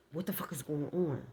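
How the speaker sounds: usual speaking voice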